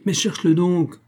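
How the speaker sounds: high-pitched urging cry; impatient exclamation. A narrator's reading. Georges urging Tony to find the shoe, exclaiming. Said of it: "s'écria Georges".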